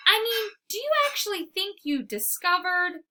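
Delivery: a lightly voiced incredulous golden dog; skeptical dog voice. A narrator's reading. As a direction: scoff at him as the big doubtful dog.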